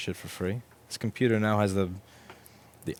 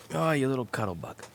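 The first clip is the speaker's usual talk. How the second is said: cooing at cat; 4 semitones higher than usual. Real, not acted. Owner cooing at cat as cuddle bug. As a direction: coo to the little cat.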